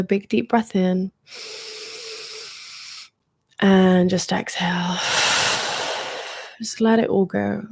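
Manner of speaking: calm guide easing listeners into relaxation; guided meditation. Acted.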